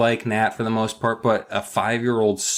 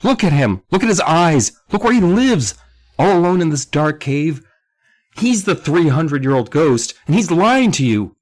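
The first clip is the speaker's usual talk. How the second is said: high, loud urgent warning; emotional outcry; 9 semitones higher, 7 dB louder than usual. A narrator's reading. Sam warning against the old man, emotional outcry. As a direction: plead loudly with raised pitch. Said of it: "Sam added with emotion".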